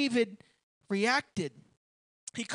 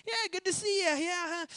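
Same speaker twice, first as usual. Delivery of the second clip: nervous small talk; 6 semitones higher than usual. Retold or imitated.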